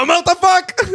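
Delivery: very high, loud comic shout; mock outraged yell. Friend echoing the bus man's punchline in a very high comic shout. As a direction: belt it out as a parody.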